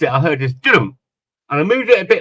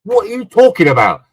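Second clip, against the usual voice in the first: louder, puzzled what are you talking about; baffled retort; 5 semitones higher, 6 dB louder than usual. Retold or imitated. Husband answering his thankful wife, loud and baffled. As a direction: retort with mild exasperation, a bit louder. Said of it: "I said".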